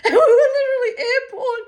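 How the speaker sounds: high, loud laughter breaking through the words; cracking up while telling it; laughing hard, voice climbing high. Real, not acted. Laughing retelling the seesaw fall.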